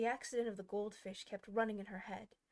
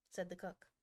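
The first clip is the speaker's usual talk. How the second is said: ordinary delivery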